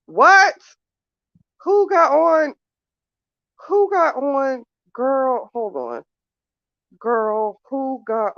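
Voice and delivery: high, loud what; can't believe what she's reading; shocked disbelief. Real, not acted. Shocked at text.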